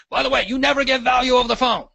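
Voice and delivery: quick, high mock mentor voice; a real estate mentor lecturing a rookie, fast and high; mentor's advice. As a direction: rattle it off like an old coach giving a rule. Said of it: "and they said".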